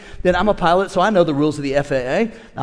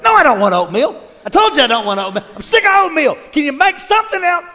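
high, loud complaining rant; hammed-up grumpy spouse; a husband snapping at his wife over oatmeal; mock irritated outburst; 9 semitones higher, 5 dB louder than usual.

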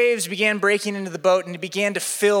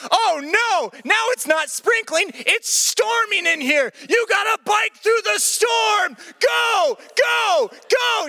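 storming shout; 12 semitones higher, slower than usual. Retold or imitated. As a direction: yell them through the storm like a drill coach. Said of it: "everything got serious".

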